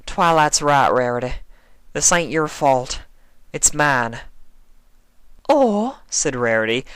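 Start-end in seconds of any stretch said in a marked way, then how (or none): 0.1-4.2 higher, louder guilty outburst; Applejack, self-blaming. A narrator's reading. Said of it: "Applejack stamped a hoof". 5.5-6.0 delicate hazy voice; Rarity, dazed. A narrator's reading.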